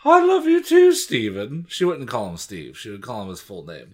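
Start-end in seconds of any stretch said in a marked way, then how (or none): 0.0-1.7 loud, very high mom voice; exaggerated motherly falsetto; doting mother. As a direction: swoop up high like a gushing mother. Voicing the mother in story.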